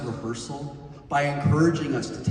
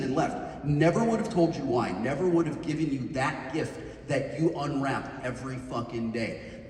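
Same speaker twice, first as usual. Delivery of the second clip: usual speaking voice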